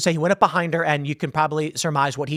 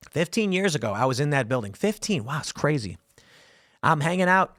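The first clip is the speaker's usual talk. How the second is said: usual speaking voice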